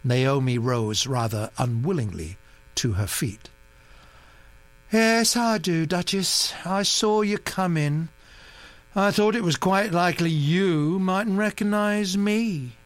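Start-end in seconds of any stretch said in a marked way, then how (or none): 4.9-12.8 lazy drawl. Acted. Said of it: "She drawled the words lazily".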